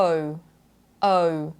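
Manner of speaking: 'O' as a smooth glide between two vowels; British O diphthong. Shown as a demonstration. Teacher showing learners her British vowel, gliding O. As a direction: round off 'O' into a second vowel. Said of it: "So when I say it".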